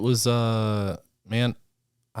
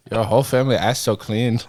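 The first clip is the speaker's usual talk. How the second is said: put-on Korean accent; 3 semitones higher, 4 dB louder than usual.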